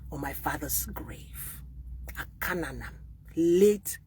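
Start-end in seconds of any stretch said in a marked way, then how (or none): 0.1-2.9 whispered oath. Real, not acted. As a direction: swear it quietly to the grave. Hushed solemn oath.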